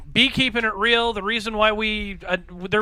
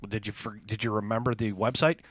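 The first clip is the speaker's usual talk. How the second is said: quiet aside; 10 semitones lower, 10 dB quieter than usual. Acted. Prompting himself in aside.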